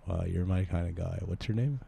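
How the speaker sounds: low-key, softly creepy oddball; odd sheltered loner. Acted. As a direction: keep the stranger quiet and subdued. Improv character on water slide.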